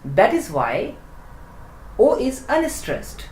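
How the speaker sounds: ordinary talk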